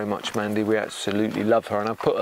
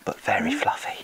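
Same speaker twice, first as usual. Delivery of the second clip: whispering; 12 semitones higher than usual. Real, not acted. Whispered aside.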